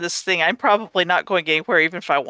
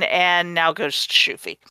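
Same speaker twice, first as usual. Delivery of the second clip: ordinary delivery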